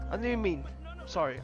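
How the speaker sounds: normal talking voice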